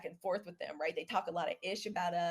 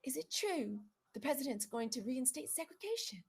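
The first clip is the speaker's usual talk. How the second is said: higher, softer imitation of her asking; hushed question; 4 semitones higher, 7 dB quieter than usual. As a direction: imitate a delicate, hushed inquiry. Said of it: "And she's like".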